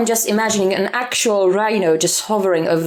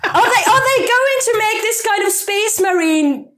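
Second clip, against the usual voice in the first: laughing; 9 semitones higher than usual. Real, not acted. Laughing at the GoPro joke.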